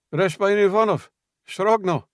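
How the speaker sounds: agitated shout through a door; calling through a heavy door, urgent; muffled through door. A narrator's reading. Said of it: "A muffled voice, agitated".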